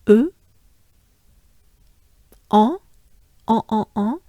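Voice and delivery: e then nasal en repeated; French vowels. Shown as a demonstration. Teacher isolating the vowel sounds. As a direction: model the nasal en several times. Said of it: "break down most of the vowel".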